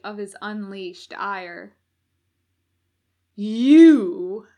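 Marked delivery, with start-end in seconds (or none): 3.3-4.5 sudden loud menacing snarl; menacing roar. A narrator's reading. Demon to Crowley, sudden menacing roar. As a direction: bellow it as a furious demon. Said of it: "he uttered".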